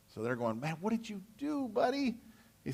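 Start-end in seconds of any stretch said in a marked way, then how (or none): 0.6-2.2 higher, softer, exasperated; exasperated scold. Acted. Judah's men chiding Samson, higher exasperated voice. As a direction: chide a reckless friend.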